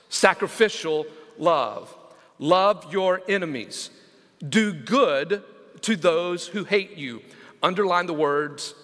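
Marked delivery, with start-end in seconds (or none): 2.4-7.2 scripture reading. Acted. Reads scripture slowly.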